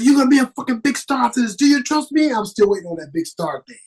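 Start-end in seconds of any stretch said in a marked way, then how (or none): 0.0-2.3 fast pep talk. Retold or imitated. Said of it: "He said".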